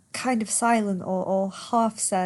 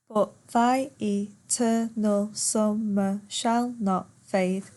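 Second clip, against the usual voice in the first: slow, syllable-by-syllable verse reading; per suku kata; slower than usual. Shown as a demonstration. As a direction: count out the line, one beat per syllable. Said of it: "Ten syllables".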